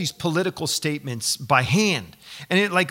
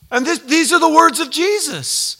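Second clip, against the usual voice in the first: normal talking voice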